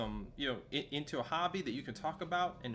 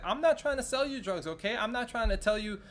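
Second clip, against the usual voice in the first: higher and louder, insistent denial; defensive therapist; 5 semitones higher, 6 dB louder than usual. Retold or imitated. Imitating the therapist denying she sells drugs, loud and defensive. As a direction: push back hard like a flustered professional. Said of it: "She's like".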